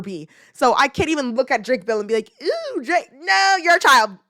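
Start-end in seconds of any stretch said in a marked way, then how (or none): 2.4-4.2 high cooing that turns into a firm no; mock swoon to refusal. Viewer refusing to fancy a child star, mock swoon then scolding. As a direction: coo high, then reject it emphatically.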